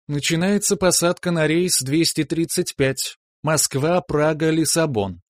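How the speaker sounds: velvety high announcer's voice over a PA; airport announcement. Acted. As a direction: read it like a terminal boarding call. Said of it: "бархатно разнёсся голос дикторши".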